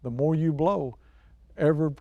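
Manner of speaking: ordinary talk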